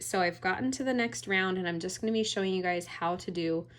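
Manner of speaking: ordinary delivery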